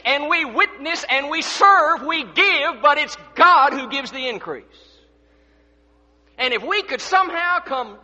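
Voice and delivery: forceful pulpit preaching with weighted key words. Real, not acted. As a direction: preach it out to the whole congregation.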